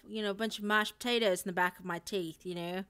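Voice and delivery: put-on mouth-full, mushy Australian accent. Streamer explaining how they make it, mushy Australian accent. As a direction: talk Australian as if mashed potato sits behind your teeth. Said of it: "my Australian one".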